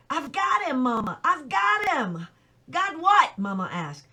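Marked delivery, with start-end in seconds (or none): 0.1-2.3 put-on boy telling Mama about his coon, excited; excited boast. A narrator's reading. 2.7-3.4 much higher and louder, alarmed; mother demanding an answer, startled and loud; startled question. A narrator's reading. Mama asks in alarm.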